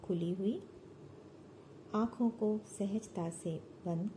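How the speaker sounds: measured, soothing meditation guide; unhurried yoga nidra instruction; guided meditation. Acted. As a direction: lead them into relaxation with long pauses between phrases.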